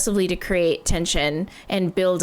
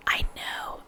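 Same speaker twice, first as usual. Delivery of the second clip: a real breathy whisper, much softer; 9 dB quieter than usual. Real, not acted. Viewer reacting to a song whispers to themself. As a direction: whisper it to yourself. Whispered aside while rewinding.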